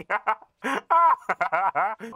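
loud rising laughter fit; loud high laugh. Real, not acted. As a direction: crack up loudly. Laughing at Frank's smell.

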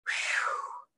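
soft, breath-only "whew"; sigh of relief. A narrator's reading. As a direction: blow out air in relief. Monkeys relieved at the portal.

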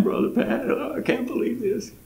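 high, soft, tearful imitation; a touch of a weeping old woman; tearful old lady. And I'm crying and she's crying.

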